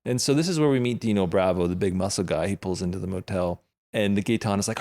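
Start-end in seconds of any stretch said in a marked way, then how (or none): none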